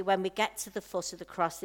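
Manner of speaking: ordinary delivery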